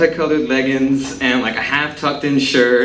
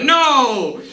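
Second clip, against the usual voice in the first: heroic shout; 12 semitones higher than usual. Retold or imitated. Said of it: "and I'd go".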